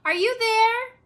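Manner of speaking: put-on girl calling up the stairs for her father. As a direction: call for her dad through the house. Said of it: "she called".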